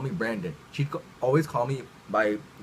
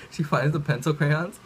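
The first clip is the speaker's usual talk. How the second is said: ordinary talk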